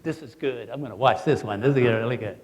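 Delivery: the sleepy cat wakes to enjoy the fight, low and gleeful. Retold or imitated. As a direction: savor the coming fight with a low laugh. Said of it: "the other cat that's asleep".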